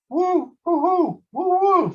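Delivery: high hooting owl cry, sung out; owl call. Acted. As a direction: hoot to call her friends. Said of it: "Big Mama called".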